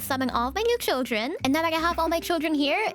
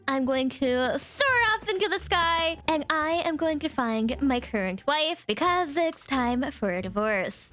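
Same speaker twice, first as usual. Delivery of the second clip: normal talking voice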